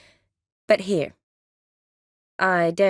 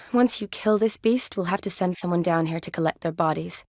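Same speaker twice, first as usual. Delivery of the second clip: usual speaking voice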